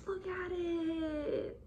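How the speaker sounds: high, long drawn-out squeal; thin high gush of excitement; delighted squeal. Real, not acted. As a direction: squeal it slowly, overcome with delight. Overcome by delight at halter.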